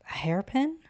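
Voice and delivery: high, rising questioning tone; surprised question, sharply rising; puzzled surprise. Acted. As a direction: ask it in puzzled surprise, pitch climbing. Puzzled at object handed over.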